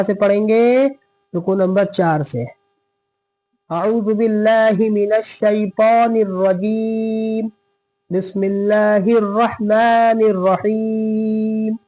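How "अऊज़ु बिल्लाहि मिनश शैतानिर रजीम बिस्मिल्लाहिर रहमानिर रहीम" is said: slow, drawn-out melodic recitation; Quran recitation. Shown as a demonstration. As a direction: chant each word slowly and evenly. Quran recitation in a tajweed lesson.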